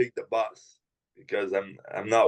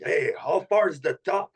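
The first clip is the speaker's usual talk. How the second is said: retold yelling; 6 semitones higher than usual. Retelling shouts to people on a mountain climb, mild mock yell. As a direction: imitate calling out to people without really shouting. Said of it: "I start yelling at people".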